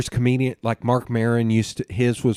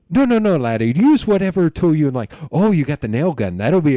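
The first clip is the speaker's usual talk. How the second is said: loud, high mock Irish accent; hammed-up Irishman, pitched up and insistent; 6 semitones higher, 7 dB louder than usual.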